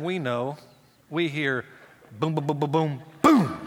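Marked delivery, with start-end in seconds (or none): 2.2-3.6 mock crash sounds, last one loud; imitated thuds. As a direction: boom boom building to a loud final boom. Imitating child falling down chute.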